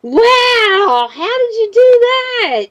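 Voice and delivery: very high, drawn-out mock amazement; exaggerated squeal of wonder; daughter's amazed voice. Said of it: "and she said".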